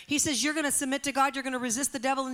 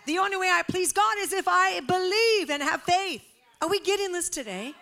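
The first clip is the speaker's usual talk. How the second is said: loud, high declaration; full-force preaching; 8 semitones higher, 7 dB louder than usual. Real, not acted. Preacher declaring faith to the church, loud and high. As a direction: proclaim it to the rafters.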